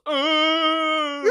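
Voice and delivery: loud high grunt, hammed up; imitated Charizard noise. Retelling a meme where James roars like Charizard. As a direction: blurt a silly monster roar. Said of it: "Make a noise like a Charizard".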